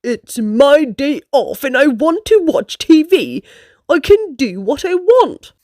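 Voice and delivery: grumpy bear-dragon, a touch gruff. A narrator's reading. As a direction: brush her off sulkily. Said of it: "with a grumpy face".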